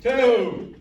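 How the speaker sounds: loud countdown, building to go; shouted countdown. Real, not acted. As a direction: bark the countdown to start. Countdown to start the round.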